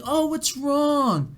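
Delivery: high, drawn-out sympathetic cooing; sweet, concerned mom. Retold or imitated. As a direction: coo it slowly like a worried mother. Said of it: "like, so sweet about it".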